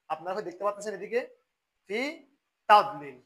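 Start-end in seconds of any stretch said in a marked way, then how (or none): none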